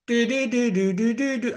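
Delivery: high, mock sing-song doodling; sing-song doo-doo-doo. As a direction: sing nonsense syllables in a high voice. Mimes vacant thinking before question.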